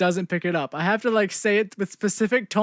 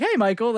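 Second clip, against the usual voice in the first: "Hey, Michael" with a rising question tune, a little higher; 4 semitones higher than usual. Shown as a demonstration. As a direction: call "Hey, Michael" as if asking. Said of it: "I have to say it like that".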